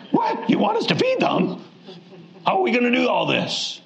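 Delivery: very high, shouted disbelief; hammed-up shocked disciples yelling. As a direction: cry out as stunned disciples. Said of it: "a little bit of shock".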